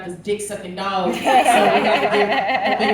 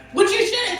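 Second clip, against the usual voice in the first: ordinary talk, nothing marked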